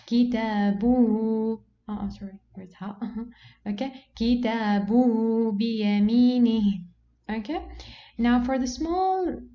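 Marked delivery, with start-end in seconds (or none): 0.0-1.6 Kitabuhu slow and loud, long vowels held; small-alif demonstration. Shown as a demonstration. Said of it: "how we are going to pronounce it". 4.1-6.9 Kitabuhu biyaminihi slow and loud, each long vowel held; long-vowel demonstration. Shown as a demonstration. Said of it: "how we are going to pronounce it".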